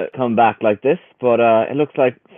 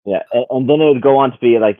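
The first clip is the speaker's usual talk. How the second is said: ordinary delivery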